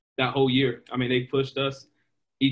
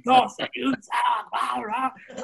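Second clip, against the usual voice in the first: imitating hecklers; 9 semitones higher, much slower than usual.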